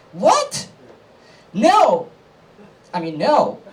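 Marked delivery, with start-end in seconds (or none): 0.1-2.1 very high, indignant outburst; shocked denial. Acted. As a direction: blurt it out, hammed-up shock. Acted shocked reply to question.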